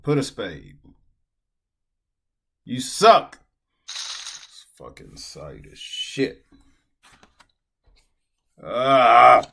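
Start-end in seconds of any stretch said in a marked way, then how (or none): 2.6-3.4 much higher, much louder, furious yell; angry shout. Real, not acted. Player furious at the site, shouting. 3.9-6.4 slow, low, whispered grumble; bitter whisper. Real, not acted. Player cursing the site, whispered and slow. 8.6-9.4 much louder furious shout; frustrated yell. Real, not acted. Player yelling in frustration at the lost pot. Frustrated cry after loss.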